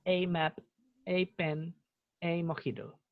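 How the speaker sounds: "a" said as the letter name "ey"; long-A article. Shown as a demonstration. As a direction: say "a" like "ey" before each noun. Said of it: "más gringo, en verdad".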